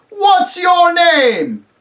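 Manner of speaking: high, slow, roared question; bawling. Acted. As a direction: roar it up at a giant. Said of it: "he bawled up at the giant".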